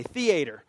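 "theater" drawn out as THEE-AY-ter, stressed; emphatic "theater" with a long AY in the middle; Southern pronunciation. Shown as a demonstration. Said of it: "we say theater".

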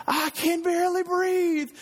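high, slow, drawn-out mock child's wail; hammed-up kid wailing from the back seat. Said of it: "J C's in the back going".

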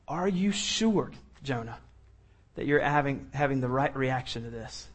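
put-on patient parent to a sulking child; gentle questioning. God questioning a sulking Jonah, soft and patient.

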